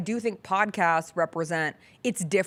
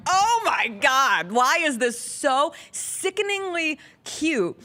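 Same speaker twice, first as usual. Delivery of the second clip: exasperated gushing; 8 semitones higher, much slower than usual. Retold or imitated. Fan reacting to a celebrity couple's banter, high drawn-out gush. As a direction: stretch it out like you can't stand the cuteness.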